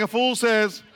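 country twang. Shown as a demonstration. Preacher asks the crowd for twang, Southern twang. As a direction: say "A fool says" in a hammed-up Southern twang. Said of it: "give me some twang".

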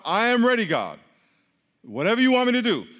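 loud, high, eager declaration; emphatic readiness. Shown as a demonstration. If you tell God you're ready, emphatic pledge. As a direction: proclaim it to God with force.

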